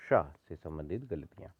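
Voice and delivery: usual speaking voice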